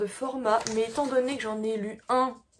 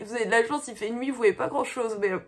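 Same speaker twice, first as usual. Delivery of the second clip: normal talking voice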